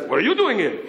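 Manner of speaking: milder echo of the challenge; Yossel echoing back. Retold or imitated. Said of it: "Yossel says".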